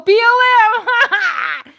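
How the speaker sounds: high, loud, slow dawning cry; the penny drops, loud and stretched; startled realization. Real, not acted. Catching his own mix-up, a loud high exclamation.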